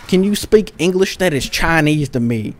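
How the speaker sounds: a loose old-guy impression, barely leaning into it; mild Bush-ish old man, mostly own voice; George W. Bush. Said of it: "sounded like George W. Bush".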